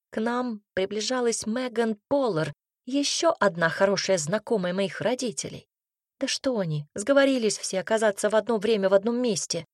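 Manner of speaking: ordinary delivery, nothing marked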